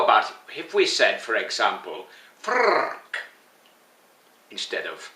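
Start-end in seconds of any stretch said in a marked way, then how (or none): 2.4-3.2 frrrk with a long rolled R, high; frrrk, R rolled like a Scot. Shown as a demonstration. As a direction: trill the R in frrrk. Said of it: "how long you roll your R's".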